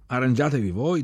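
loud, brusque brush-off; curt dismissal. Acted. Chief tells the youths to manage alone, loud and brusque. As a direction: toss it back impatiently. Man brushes off their question.

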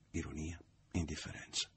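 ordinary delivery

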